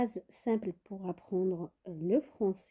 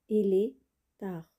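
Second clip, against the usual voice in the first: usual speaking voice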